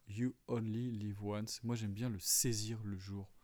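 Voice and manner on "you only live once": slow, hammed-up English accent on the English phrase. Shown as a demonstration. Teacher mocking his own English accent for his audience. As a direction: put on a comic English accent, drawing the words out. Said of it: "comme je le disais tout à l'heure".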